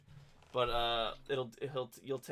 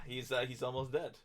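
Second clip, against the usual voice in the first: ordinary delivery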